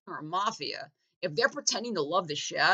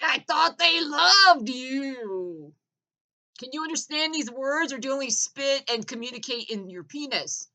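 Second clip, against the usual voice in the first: slow, higher put-on taunting voice; mocking taunt; 5 semitones higher, 3 dB louder than usual. Comic taunting a ruined investor, slow put-on voice. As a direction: drag out the sneer. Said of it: "Being like".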